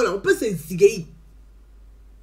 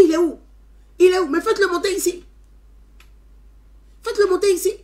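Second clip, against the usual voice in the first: higher, louder eager summons; eager call; 9 semitones higher, 8 dB louder, faster than usual. Retold or imitated. Mayor calling for the boy to come up, eager. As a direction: call out warmly excited to fetch the boy.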